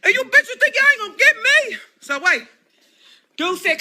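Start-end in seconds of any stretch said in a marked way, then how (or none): 0.0-1.8 hollering half laughing, pitch up; yelling out the car window at the men. Retold or imitated. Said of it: "I'm out the window like".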